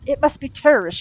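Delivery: a brisk, irritated ex-nun snapping; mild snap. A narrator's reading. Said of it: "she snapped".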